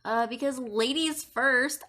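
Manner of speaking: usual speaking voice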